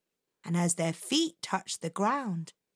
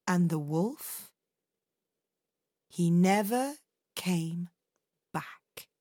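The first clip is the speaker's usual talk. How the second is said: fading ending; 4 semitones lower than usual. A narrator's reading. Ominous story ending.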